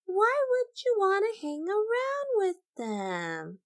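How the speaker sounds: high, slow squeaky bird voice; squeaky cartoon bird pleading; high character voice. Teacher voicing a bird character.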